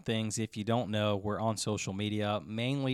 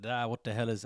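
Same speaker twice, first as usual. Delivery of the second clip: put-on Irish accent. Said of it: "I can't do that".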